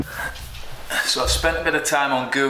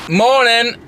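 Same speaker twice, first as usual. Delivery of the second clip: big cheery hello; loud greeting; 11 semitones higher, 11 dB louder than usual. Real, not acted. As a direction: bellow a bright good morning.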